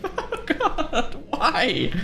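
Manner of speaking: high, slow, laughing in disbelief. Real, not acted. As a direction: laugh through the words. Player laughs at the outfit.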